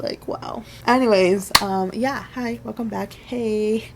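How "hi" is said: ordinary talk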